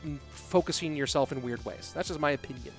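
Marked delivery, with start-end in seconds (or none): none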